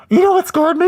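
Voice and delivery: ordinary talk, nothing marked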